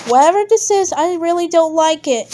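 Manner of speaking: nervous outburst in a creepy place; frightened complaint; scared. Acted. Scared character in nightmare realm.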